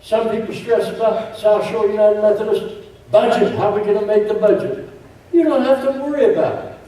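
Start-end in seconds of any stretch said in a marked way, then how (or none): 5.3-6.8 higher, fast, lean on you; emphatic reassurance. Acted. Pastor telling budget worriers, higher and quicker.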